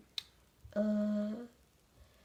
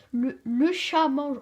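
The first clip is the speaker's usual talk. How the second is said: slow read-aloud; 3 semitones higher, 5 dB louder, much slower than usual. Shown as a demonstration. Child teacher reads example sentence.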